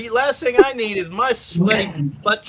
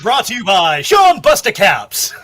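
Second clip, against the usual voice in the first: loud, raised ad-read voice; mock sponsor announcer; 4 semitones higher, 6 dB louder than usual. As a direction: belt out a fake sponsorship plug.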